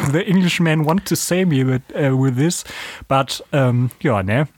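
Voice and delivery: ordinary talk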